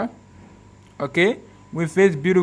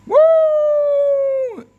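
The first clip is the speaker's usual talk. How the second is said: a high, loud cheer of delight; excited whoop; 5 dB louder than usual. Real, not acted. Showing the phone camera to viewers, a delighted high whoop. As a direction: whoop like the trick just worked.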